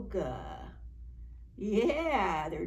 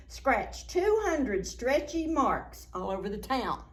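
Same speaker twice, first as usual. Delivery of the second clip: higher, playful read-aloud; lively storytime reading; 6 semitones higher than usual. Acted. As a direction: bounce the rhymes for the children. Reads rhyming picture book.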